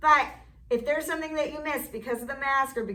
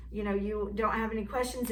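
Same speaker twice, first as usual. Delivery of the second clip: ordinary talk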